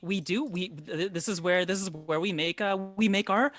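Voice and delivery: high, halting, eager hostess; flustered baker woman. Acted. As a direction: offer the house specialty in a fluster. Game master plays baker Bella.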